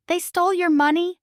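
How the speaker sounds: high, loud gasp of disbelief; shocked gasp. Acted. Wendy reacts to the baker's theft, shocked. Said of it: "Wendy gasps".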